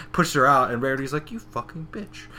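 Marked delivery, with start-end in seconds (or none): 1.3-2.3 high, very soft cursing; muttered retold insult. Rarity cursing the guest who pushed her out, muttered. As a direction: curse under your breath, pitched up.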